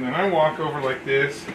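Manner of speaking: normal talking voice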